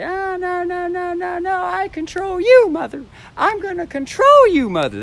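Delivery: put-on very high, bratty child voice with a Japanese inflection. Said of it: "uh, Japanese inflection".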